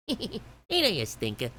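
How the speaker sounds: a light Bugs Bunny, through the nose; cheeky cartoon rabbit, lightly done. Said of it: "Yeah, I did Bugs Bunny".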